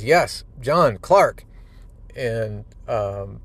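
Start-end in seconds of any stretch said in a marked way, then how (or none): 0.0-1.4 rising, loud, high cry of recognition; loud, high happy realization; joyful recognition. Acted. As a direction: it all comes flooding back. Superman's memory returns, reunion.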